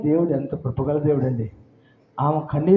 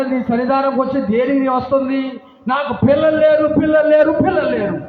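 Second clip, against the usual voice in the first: high, loud wailing plea; anguished cry; 8 semitones higher, 4 dB louder than usual. Retold or imitated. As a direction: cry out with no children to give. Hannah cries out to priest.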